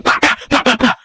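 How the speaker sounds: repeated mock sound effect, jumping high; imitated bah noises. Dave going at Jumbo, imitated bah noises. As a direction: punch out rapid nonsense bahs. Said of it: "So Dave is going".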